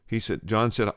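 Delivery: ordinary delivery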